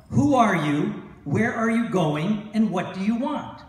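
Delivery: loud, slow yell down from the fort; centurion yelling. Retold or imitated. Centurion shouting down at the lost rabbi, loud. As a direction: bark the challenge down from the wall. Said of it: "the centurion yells down at him".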